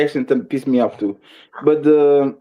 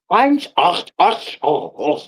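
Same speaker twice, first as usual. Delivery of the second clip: mock German; 2 semitones higher, slower than usual. Joking with friends about German, mock German accent. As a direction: clear the throat on "ach" like a German caricature. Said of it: "A battle between English and a German".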